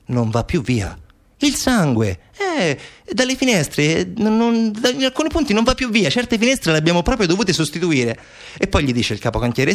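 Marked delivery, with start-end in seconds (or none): 0.1-8.2 high, halting foreman making excuses; higher-pitched, hesitant workman fumbling for words; foreman's excuse. Acted. Foreman explains bloodstains to interviewer, flustered excuse.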